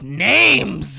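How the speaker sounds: one big loud drawn-out yell; mock yell. Possessed girl answering the exorcist, loud mock yell. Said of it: "she yells".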